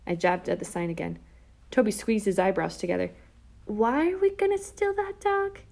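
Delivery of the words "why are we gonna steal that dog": put-on high-pitched, slow, baffled kid voice; puzzled little brother. A narrator's reading. Little brother questioning his sister's plan, puzzled. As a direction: ask it high and drawn out.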